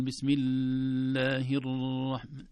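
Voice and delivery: "بسم الله الرحمن" slow, ل held and ر trilled long; overlong doubled letters. Shown as a demonstration. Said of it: "ولا نقول". («بسم الله الرحمن» بإطالة اللام وتكرير الراء خطأً)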